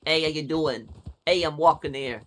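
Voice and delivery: put-on a street-tough New Yorker in a New York accent; punchy New York accent, played up for chat. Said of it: "Can you, can you do a New York accent".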